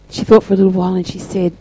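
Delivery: normal talking voice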